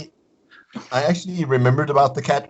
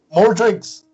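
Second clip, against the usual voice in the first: loud, high shout for more drinks; hearty tavern call in gnomish; 9 semitones higher, 6 dB louder than usual. Acted. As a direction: bellow across the tavern. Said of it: "in gnomish".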